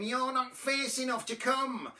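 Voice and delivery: high scornful put-down; lightly voiced mean sister; sisters' taunt. A narrator's reading. Sisters turning on Drip in story.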